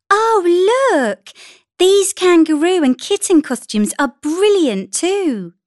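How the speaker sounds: high, loud-ish gushing mother voice; enthusiastic mother. A narrator's reading. Mother cooing over costumes for her daughter, enthusiastic. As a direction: lift it high with delight.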